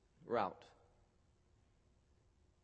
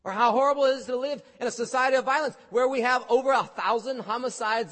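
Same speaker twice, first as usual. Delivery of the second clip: loud, high, impassioned preaching; preaching at full force; 9 semitones higher, 8 dB louder than usual. Acted. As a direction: thunder it from the pulpit. Preacher decrying violence.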